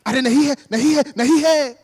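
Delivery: loud, high, protesting husband; hammed-up loud film-husband pleading; husband in melodrama. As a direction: cry out in agitated Hindi denial. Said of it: "He's like".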